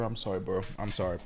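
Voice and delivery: soft apology. Real, not acted. Apologizing softly to his dog.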